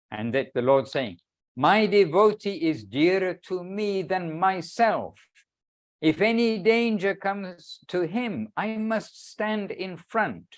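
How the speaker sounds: measured, ringing recitation of sacred words; solemn devotional reading. Acted. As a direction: read it out grandly, lingering on each phrase. Reads a devotional text aloud.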